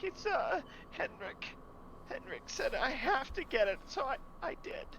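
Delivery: put-on very high, shaky child confessing; a small boy still choked up, giving it up; tearful boy. Boy confesses after crying.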